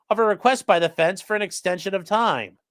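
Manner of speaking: normal talking voice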